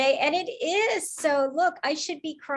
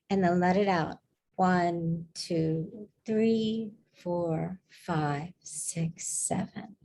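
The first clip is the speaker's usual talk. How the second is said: calming exhale count; 5 semitones lower, much slower than usual. Real, not acted. Counts a slow exhale for the group.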